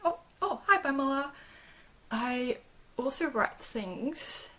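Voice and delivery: ordinary delivery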